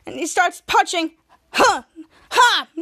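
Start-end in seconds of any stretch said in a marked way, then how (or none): 1.5-2.7 fighting grunts. Retold or imitated. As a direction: grunt with each punch. Captain Man punching goons.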